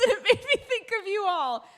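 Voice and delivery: high and loud through laughter; giggling at the chat; laughing. Real, not acted. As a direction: say it while cracking up. Laughing about thirsty edits.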